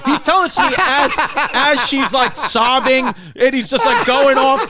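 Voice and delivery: can barely get it out for laughing; laughing in disbelief. Real, not acted. Telling a friend about the absurd funeral, laughing high.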